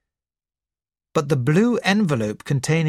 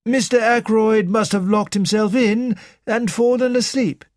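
a hint of a higher, deferential manservant; polite butler answering the doctor; lightly voiced butler; 7 semitones higher than usual. Butler reporting to doctor, lightly voiced and respectful.

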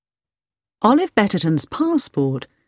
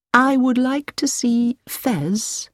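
higher, slow, careful and measured; choosing each word with care; carefully; 8 semitones higher, much slower than usual. A narrator's reading. Hilary answers Mrs Baker carefully, weighing every word. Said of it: "said Hilary carefully".

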